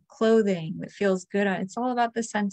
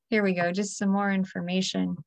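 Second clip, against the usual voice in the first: ordinary delivery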